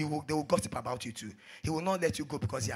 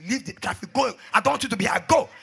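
raised-voice reprimand, building louder; angry dismissal; 6 semitones higher, 10 dB louder than usual. Acted. Pastor ordering the minister off traffic, angry dismissal. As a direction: send him off sharply in public.

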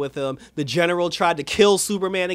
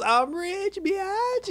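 much higher, mock-triumphant squeal; gloating brag; much slower than usual. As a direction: squeal it like the rich show-off. Replaying Jimmy's gloating line.

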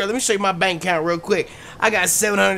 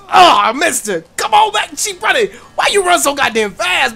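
high, loud chasing call; frantic call; 8 semitones higher, 5 dB louder than usual. Real, not acted. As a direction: holler after her. Chasing a jogger in game.

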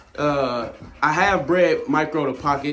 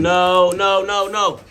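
sharp, louder no's at the dog; firm, loud no to a misbehaving dog; loud scolding; 5 semitones higher, 6 dB louder, much slower than usual. Real, not acted. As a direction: stop the dog with a raised voice.

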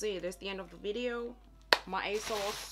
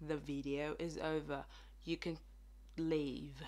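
low, softer British imitation; bad British accent; 4 semitones lower, 6 dB quieter than usual. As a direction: deliver a clumsy British sign-off. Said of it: "That was the worst British accent ever".

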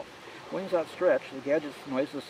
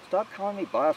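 sharp, higher and louder snap at Charlie; snapped; 9 semitones higher, 4 dB louder than usual. A narrator's reading. Narrator snapping at Charlie to stop calling him boss. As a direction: bite the words off, irritated. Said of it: "I snapped".

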